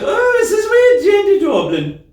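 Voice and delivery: put-on high, slow Irish accent. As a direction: sing it out in an Irish lilt. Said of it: "He's Irish".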